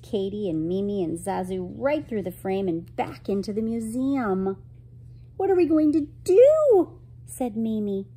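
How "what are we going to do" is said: high, loud, frantic question; panicked cry. Acted. Girl chased by the angry waiter, panicked cry. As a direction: wail it in a panic.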